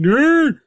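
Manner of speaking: loud, high-pitched yelp of protest. Acted. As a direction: yelp sharply as the victim. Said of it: "you hear a yelp from him".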